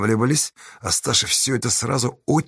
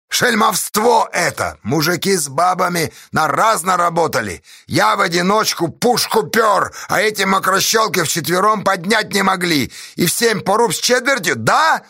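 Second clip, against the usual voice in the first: high, outraged protest; indignant tirade; 7 semitones higher than usual. Acted. Pozdey crying swindle over pay, indignant tirade. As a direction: harangue them about the injustice.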